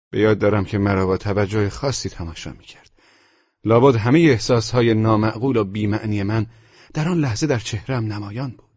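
plain reading voice

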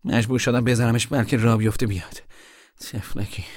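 gently excusing himself; apologetic. Acted. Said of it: "با لحنی پوزش طلبانه".

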